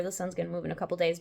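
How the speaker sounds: normal talking voice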